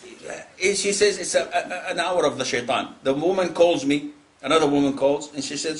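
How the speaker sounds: usual speaking voice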